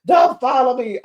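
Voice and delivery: high, loud mock-quarrel snap; acted arguing. Retelling Lisa arguing with Heather, loud and high. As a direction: raise pitch and volume as if quarrelling. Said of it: "they arguing".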